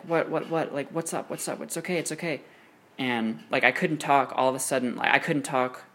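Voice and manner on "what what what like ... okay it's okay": lightly voiced girlfriend, higher and softer; a hint of the worried girlfriend, gently soothing; comforting girlfriend. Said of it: "she was just like".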